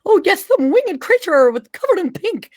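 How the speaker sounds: high, dotty humming and muttering; Mad Hatter voice. Acted. Mad Hatter checking his guest list, high and scatterbrained. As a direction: pore over a list in a high character voice. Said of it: "he goes and grabs a clipboard".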